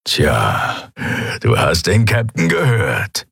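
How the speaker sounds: lightly growling pirate henchman; growled. A narrator's reading. Graufell to his captive, light growl. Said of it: "brummte er".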